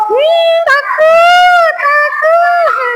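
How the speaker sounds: high, slow, trembling fearful moan; scared face demo.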